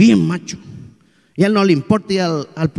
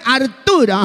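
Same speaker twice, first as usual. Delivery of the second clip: louder name; 6 semitones higher, much slower than usual. Acted. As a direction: say Arturo higher and stronger. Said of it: "dígamelo más fuerte".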